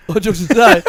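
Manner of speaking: can't keep a straight face, cracking up; bursting out laughing mid-phrase. Real, not acted. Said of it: "come on".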